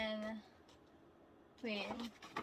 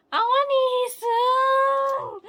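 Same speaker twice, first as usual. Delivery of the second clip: loud, high, slow wailing demand; whiny shout; 14 semitones higher, 17 dB louder, slower than usual. Real, not acted. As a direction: cry out for him. Wishing for her bias.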